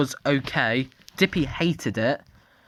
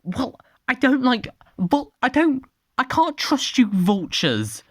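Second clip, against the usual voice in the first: annoyed character voice; 9 semitones higher, 3 dB louder than usual. Acted.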